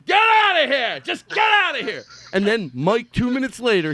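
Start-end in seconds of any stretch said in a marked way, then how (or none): 0.1-2.0 shouting get out of here, much higher and louder; retold angry shout. The bar owner throwing Mike out with a shout. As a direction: bark it like a boss who has had it. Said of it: "And he goes".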